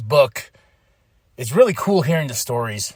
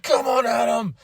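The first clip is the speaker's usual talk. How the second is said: higher shouted challenge, imitating; mock angry callout; 6 semitones higher than usual. Imitating Braun Breaker calling out Adam Pearce, higher mock shout. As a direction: bellow for the boss to come out. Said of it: "he's like".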